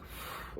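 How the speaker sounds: soft exhale of relief; acted-out relieved sigh. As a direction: let the breath go, relieved.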